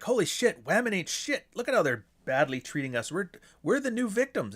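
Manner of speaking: high, indignant reader having a revelation; worked-up imitation, pitched well up; mock outrage. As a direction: act out a newly converted, aggrieved reader. Acting out a reader's outraged discovery.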